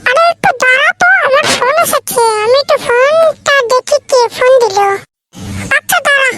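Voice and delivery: loud and fast at first, shouting over the distance; loud calling out. Acted. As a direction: call out loudly while hurrying off. Calling out about phone call. (জোরে ও তাড়াহুড়ো করে বলা)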